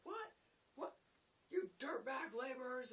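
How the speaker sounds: high, loud outraged imitation; mock yelling. Carpenter coming over to the laborer, mock furious yell. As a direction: holler insults like a riled workman. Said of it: "yelling stuff at me".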